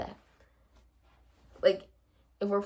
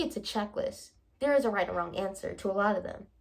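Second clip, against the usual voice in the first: normal talking voice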